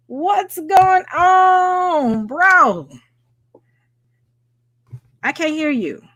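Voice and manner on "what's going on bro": high, long-drawn excited shout-out; hyped greeting. Real, not acted. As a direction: greet a buddy, really stretching it out. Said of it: "I'm the only one that's hype".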